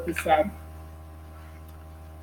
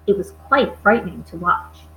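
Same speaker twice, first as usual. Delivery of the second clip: ordinary delivery, nothing marked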